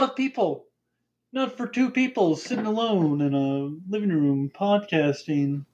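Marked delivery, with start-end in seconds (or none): none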